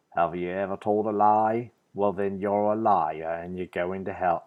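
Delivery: playing a street preacher with a shaky Kiwi accent; Ray Comfort accent. Street preacher confronting a passerby, in a failed New Zealand accent. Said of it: "that's British, not Australian. I can't do Australian".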